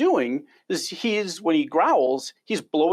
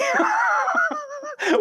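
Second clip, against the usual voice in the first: high-pitched delighted laugh. Real, not acted. Recalling the Mickey Mouse cobra growl.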